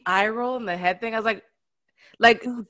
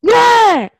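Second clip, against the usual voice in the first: loud, high happy yell; toddler shout; 13 semitones higher, 11 dB louder than usual. Retold or imitated. Mother imitates her son shouting yeah when he wants something, loud yell. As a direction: belt it like a demanding toddler. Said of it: "But if it's like".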